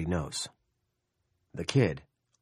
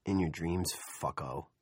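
sneering; 2 semitones lower, 6 dB quieter than usual. A narrator's reading. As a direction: sneer it with a grin. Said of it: "a version of a grin".

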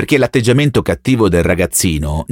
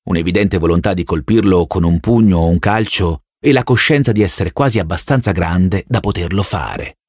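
ordinary delivery, nothing marked

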